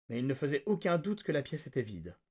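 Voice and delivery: usual speaking voice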